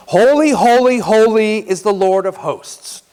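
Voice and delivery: high, loud proclamation to repeat; call for repetition. Shown as a demonstration. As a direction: lead the call for the crowd. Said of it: "here's the first half".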